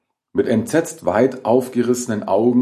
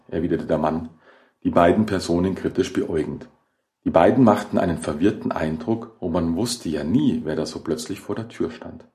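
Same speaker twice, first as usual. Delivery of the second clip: ordinary delivery, nothing marked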